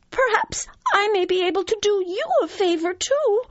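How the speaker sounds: very high squeaky pleading; frightened mouse. Acted. Mouse promising the lion a favor if spared, frightened. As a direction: stammer and beg in a tiny high voice. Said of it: "cried the frightened mouse".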